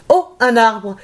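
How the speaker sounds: high excited warning call; teacher calling out. Acted. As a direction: shout it like a game leader. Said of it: "il crie".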